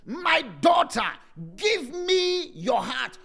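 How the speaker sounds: impassioned preaching shout. Acted. Preacher pleading scripture at climax.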